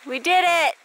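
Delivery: high, loud cheer of relief; triumphant shout. Real, not acted. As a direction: call it out in triumph. Reaching camp at last.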